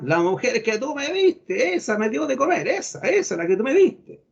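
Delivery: high, mounting mock indignation; imitated accusing anger. Preacher voices Adam blaming God, high and angrily accusing. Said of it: "ese tono de enojado".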